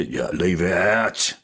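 slow, drawn-out Cockney bloke; EastEnders London accent. Acted. Old bloke on EastEnders, slow London accent. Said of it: "don't genuinely have that accent".

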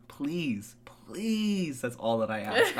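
stretched, imploring whine; pleading. Real, not acted. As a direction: plead with exaggerated desperation. Begging parents not to bring kids.

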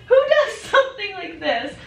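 loud, high incredulous outburst; exasperated disbelief. Retold or imitated. Friend reacting to a man who asks about exes, exasperated disbelief. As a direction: let the disbelief burst out, half laughing. Appalled reaction to the retold story.

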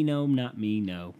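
usual speaking voice

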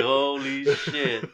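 long drawn-out, loud 'holy shit'; mock-awestruck exclamation; stunned disbelief. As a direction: stretch it out big and loud, amazed. Acting out a stunned waking reaction.

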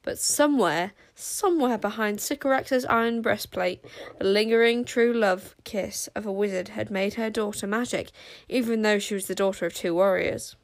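ordinary delivery, nothing marked

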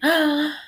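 high, sudden gasp of shock; sharp gasp. Retold or imitated. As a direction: catch your breath at the twist. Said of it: "and you do just think".

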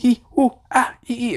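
high-pitched little squeals of pain, played for laughs; mimicked burning-feet yelps. Boyfriend mimics people on burning pool ground, high mock yelps. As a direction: squeak as if stepping on hot coals. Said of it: "It was like that".

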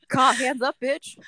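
mock cop order. Acting cop arresting bird.

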